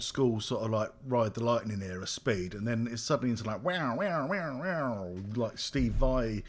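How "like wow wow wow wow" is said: imitated guitar shredding. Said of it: "[name] type noises".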